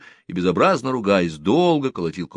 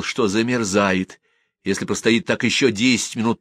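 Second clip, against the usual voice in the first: ordinary delivery, nothing marked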